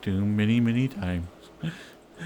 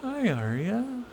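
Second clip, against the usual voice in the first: high, slow sweet greeting to dog; dog talk; 9 semitones higher, slower than usual. Real, not acted. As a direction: coo at the pet.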